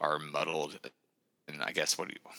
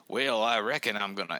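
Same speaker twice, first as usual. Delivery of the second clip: higher-pitched, hammed-up Southern accent; fake Southern accent; 5 semitones higher, 4 dB louder than usual. Mocking British actors who overdo it, in fake Southern accent. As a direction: put on a mock Southern accent. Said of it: "who cannot do a flat American accent".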